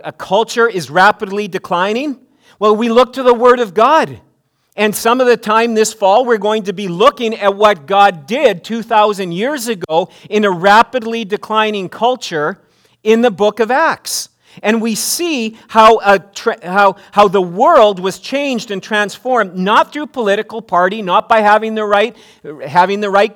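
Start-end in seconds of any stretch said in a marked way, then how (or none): none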